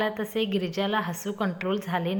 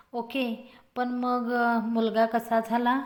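plain reading voice

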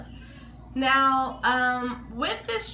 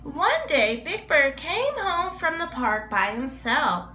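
higher, lively storybook narration; reading to children; 6 semitones higher than usual. Acted. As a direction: read it like a picture book to little kids.

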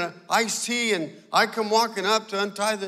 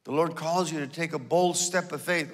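usual speaking voice

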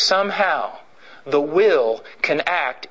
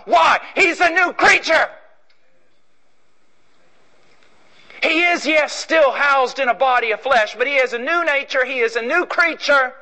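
preaching; 8 semitones higher than usual. Acted. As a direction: build it to a ringing proclamation. Preaching at full force.

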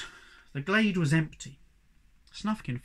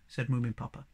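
usual speaking voice

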